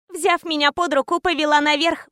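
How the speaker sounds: usual speaking voice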